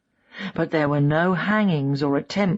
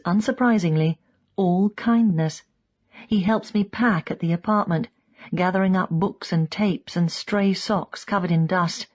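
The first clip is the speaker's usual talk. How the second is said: plain reading voice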